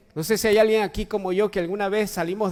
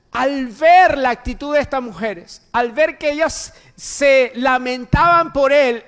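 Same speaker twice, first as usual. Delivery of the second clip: loud, high-pitched sermon delivery; full-force preaching; 7 semitones higher, 9 dB louder than usual. Acted. Preacher telling how Jesus carried the cross, full-force preaching. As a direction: drive the story home with a raised preacher's voice.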